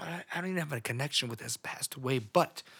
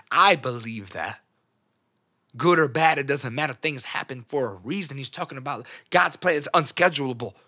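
loud, emphatic declaration of faith; raised voice full of belief; fervent conviction; 3 semitones higher, 10 dB louder than usual. Real, not acted. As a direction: proclaim it forcefully, like preaching.

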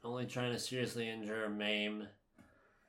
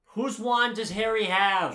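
loud, high, insistent question; exasperated demand; 7 semitones higher, 8 dB louder than usual. Real, not acted. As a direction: press hard for the answer. Exasperated quizzing of the partner.

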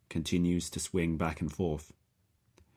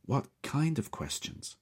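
raspy; 10 semitones higher than usual. Acted. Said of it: "I ask raspily".

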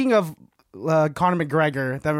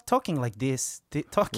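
soft, tentative Irish accent; 6 semitones lower, 6 dB quieter than usual. Shown as a demonstration. Demonstrating an Irish accent to cohosts, quietly trying it out. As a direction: try Irish, placed behind the front teeth. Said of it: "the voice for an Irish accent".

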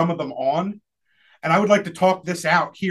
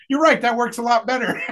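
amused agreement; 6 semitones higher than usual. Retold or imitated. Sister's quote, amused agreement.

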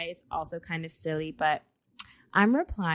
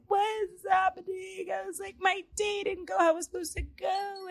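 high-pitched mock crying wail; retold crying; 13 semitones higher than usual. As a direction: wail it in a squeaky, hammed-up cry.

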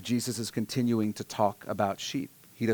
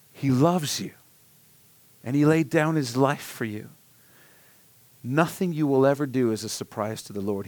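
ordinary talk

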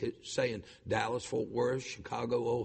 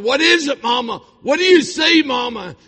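high, shouted questions to Mama; daughter's urgent cry; 12 semitones higher, 15 dB louder than usual. Retold or imitated. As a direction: imitate a daughter calling out urgently. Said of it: "my daughter said".

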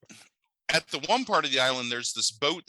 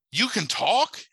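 high, pitched-up disbelieving question; startled question; 10 semitones higher, 4 dB louder, slower than usual. Retold or imitated. Woman in the movie asks the snake, high and amazed. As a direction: squeak it out in shock at a talking snake.

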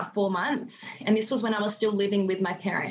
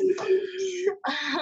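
laugh; 11 semitones higher than usual. Real, not acted. Laughs after her own outburst.

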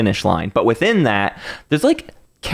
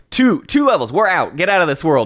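mock hurried dismissal; 6 semitones higher than usual. Voices a level rushing players on.

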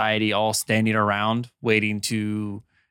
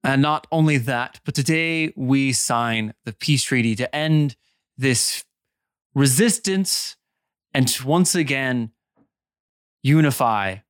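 measured public proclamation with emphatic pauses; ceremonial speechmaker; 3 semitones higher than usual. Acted. Amir announcing peace treaty to nobles, ceremonial address. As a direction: build each phrase for the assembled crowd.

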